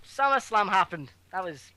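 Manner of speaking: low, flat-out bored; unenthusiastic. Real, not acted. Cohost dismissing the big event, utterly unenthusiastic. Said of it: "you cannot sound any more unenthusiastic".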